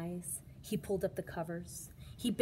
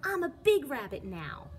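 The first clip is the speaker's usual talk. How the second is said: put-on a touch higher, louder kid boast; proud child; 8 semitones higher, 6 dB louder than usual. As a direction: boast like a small bunny. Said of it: "said Jasper".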